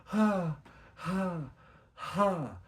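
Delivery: high cheering whoop, then ha-ha; whooping laugh. Real, not acted. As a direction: let it burst out. Bursts out in a cry-laugh.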